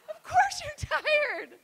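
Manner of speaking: high, slow, laughing yell; laughing shout. Real, not acted. Comic answering the tired women, laughing shout.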